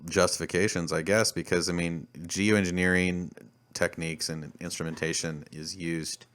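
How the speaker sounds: ordinary talk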